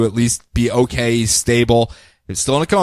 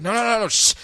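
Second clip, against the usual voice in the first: lightly hammed-up fake villain; 5 semitones higher than usual.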